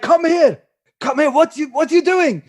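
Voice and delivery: telling off. Acted. Pretend father telling off his fake son, high mock-angry shout. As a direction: scold like an exasperated parent, acted. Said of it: "Telling him off".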